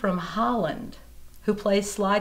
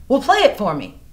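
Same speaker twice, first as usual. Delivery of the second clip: much louder, higher urging voice; eager friend; 7 semitones higher, 10 dB louder than usual. Retold or imitated. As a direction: push her to grab her guitar. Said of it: "he goes".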